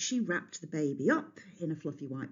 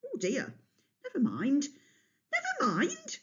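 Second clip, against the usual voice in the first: rising high, airy brush-off; airy dismissal; 11 semitones higher than usual. A narrator's reading. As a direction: wave it away lightly. Kronos brushes it off.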